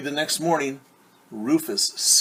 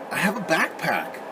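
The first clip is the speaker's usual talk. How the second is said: frowning pig; 5 semitones higher than usual. Acted. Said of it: "Rufus frowned".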